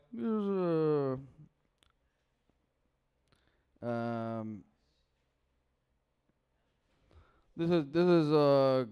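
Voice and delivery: lightly voiced mafia don; Don Corleone. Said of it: "this is my impression of, uh, the Godfather Don Corleone".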